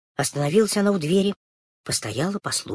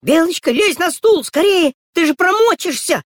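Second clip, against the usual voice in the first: high, loud frantic calling; a little girl shrieking a warning; shouting girl; 12 semitones higher, 5 dB louder than usual. Acted. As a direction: yell down from the stool in a panic. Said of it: "влезла и кричит".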